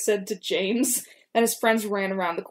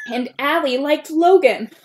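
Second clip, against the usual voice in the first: excited blurt; 5 semitones higher, 5 dB louder than usual. Real, not acted.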